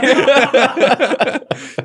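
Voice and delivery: loud high burst of laughter. Real, not acted. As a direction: crack up at the joke.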